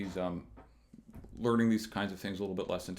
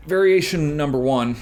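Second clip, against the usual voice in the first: announcing; 6 semitones higher, 11 dB louder than usual. Real, not acted. Announcing the variation.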